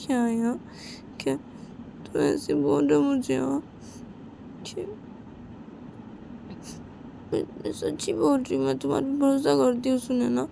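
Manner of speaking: put-on high, anxious young girl insisting; girl voice pushed high, defensive; Simran's high voice.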